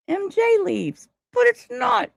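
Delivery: squeaky high, rising disbelief; mock outrage. Real, not acted. Telling chat about her shirt, squeaky high disbelief. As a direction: pitch way up as the joke lands.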